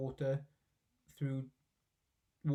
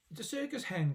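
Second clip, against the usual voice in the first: shout; 7 semitones higher than usual. A narrator's reading. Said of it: "Grandma shouted from the rooftop".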